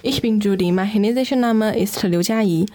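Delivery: normal talking voice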